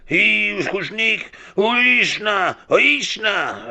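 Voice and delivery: loud, very high, drawn-out nonsense holler; mock gibberish scream. Driver venting at a stoplight, high screamed gibberish. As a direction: scream fake Russian at the top of your range. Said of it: "I would scream at a stoplight".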